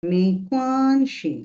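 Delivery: learner says "মেইকোয়ানশি" slowly, syllable by syllable; slow careful repeat. Real, not acted. Learner imitates the teacher's slow "মেইকোয়ানশি" with care.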